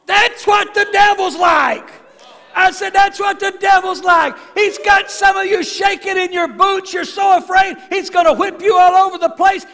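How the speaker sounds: shouted, high and slow preaching; full-force preaching. Real, not acted. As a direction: preach it out at the top of the voice.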